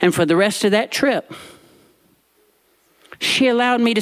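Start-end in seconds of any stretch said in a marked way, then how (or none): none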